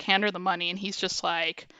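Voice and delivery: normal talking voice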